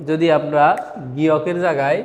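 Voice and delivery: normal talking voice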